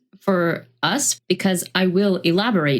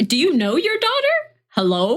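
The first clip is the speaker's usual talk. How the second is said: exasperated scolding; 8 semitones higher than usual. Retold or imitated. Scolding Lorelai in mock address.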